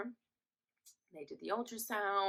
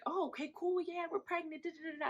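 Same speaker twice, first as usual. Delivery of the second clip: much higher, soft, rattled-off voice; imitated breezy boyfriend; 11 semitones higher, 4 dB quieter than usual. Boyfriend shrugging off pregnancy news, high breezy imitation. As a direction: brush it off in a light high babble. Boyfriend's casual reaction, acted.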